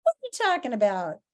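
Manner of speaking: baffled, starting very high then settling; incredulous reaction. Acted. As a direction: react in comic disbelief. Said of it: "he's like".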